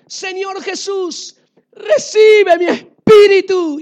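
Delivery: loud, slow, tearful plea; dying prayer. Acted. Dying Stephen to Jesus, a loud tearful plea. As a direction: give up the spirit with a sob. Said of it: "Y decía".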